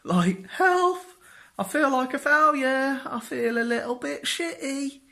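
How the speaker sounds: high, loud whiny plea; a grown man whining to his mum; whiny mommy's boy. Retold or imitated. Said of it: "I'm a bit of a mommy's boy".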